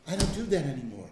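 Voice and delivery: normal talking voice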